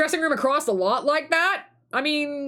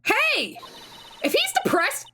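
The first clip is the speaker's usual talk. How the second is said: much higher, sharp scolding; indignant scold; 12 semitones higher, slower than usual. Acted. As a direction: tell him off, protective of the friend. Scolding the detective.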